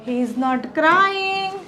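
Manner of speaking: very slow, clear dictation of each English word; teacher modelling English slowly; slow model sentences. Shown as a demonstration. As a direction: spell the sentence out slowly for learners. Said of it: "તો".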